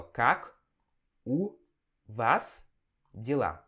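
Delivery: "Как у вас дела?" with pauses between words; word-by-word reading. Shown as a demonstration. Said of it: "É assim que a gente lê". (lendo palavra por palavra, pausado)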